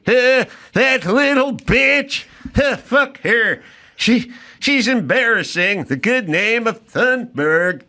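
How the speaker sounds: high, slow Swedish accent, gruff and mocking; Swedish dad accent. Swedish accent as Greta's dad grumbling about her. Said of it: "Solid accent, though".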